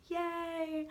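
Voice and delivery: high bright little cheer for the channel; excited cheer. Real, not acted. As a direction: cheer the family name, delighted.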